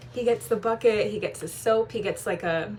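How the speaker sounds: ordinary delivery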